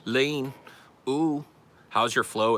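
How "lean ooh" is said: higher-pitched mock rap interjections; little rapper persona; rapper ad-libs. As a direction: toss out short hype yelps as a pretend rapper. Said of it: "like, a little rapper".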